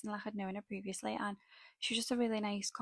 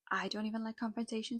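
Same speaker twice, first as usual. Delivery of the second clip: ordinary talk, nothing marked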